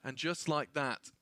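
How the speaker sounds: loud, high, fast hype commentary; live play-by-play. Acted. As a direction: call the fight like an esports caster.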